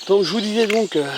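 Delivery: ordinary delivery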